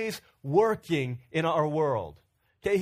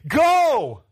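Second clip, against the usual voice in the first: much louder, higher, booming go; a preacher's loud cry; shouted command; 7 semitones higher, 9 dB louder than usual. Real, not acted. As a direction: shout the command at full force. Preacher proclaims God's command.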